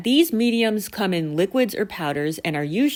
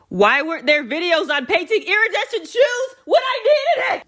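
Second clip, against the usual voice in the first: high-pitched frustrated wail; exasperated outburst; 11 semitones higher, 3 dB louder than usual. Acted. Vlogger vents about missing shoe-painting videos, exasperated high wail.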